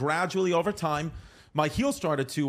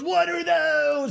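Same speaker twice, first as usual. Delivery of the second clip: mocking kid; 10 semitones higher, 5 dB louder, much slower than usual. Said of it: "points at me and goes".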